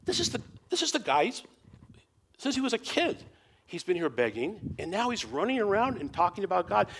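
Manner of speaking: high, astonished voices of the crowd; amazed. Retold or imitated. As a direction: marvel at the healed beggar. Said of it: "people are amazed".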